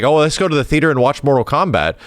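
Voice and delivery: ordinary talk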